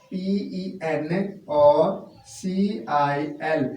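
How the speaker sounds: letters spelled out slowly one by one; teacher spelling for students. Shown as a demonstration. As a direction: dictate each letter of pencil. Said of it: "pencil है".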